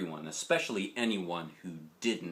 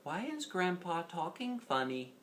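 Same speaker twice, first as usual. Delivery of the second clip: whispered question; 7 semitones higher, 6 dB quieter than usual. Acted.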